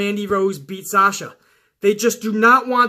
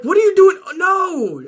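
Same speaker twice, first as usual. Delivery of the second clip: much higher, louder cry of disbelief; exasperated outburst; 9 semitones higher, 4 dB louder than usual. Retold or imitated. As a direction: yell at the screen in disbelief. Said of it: "And I'm like".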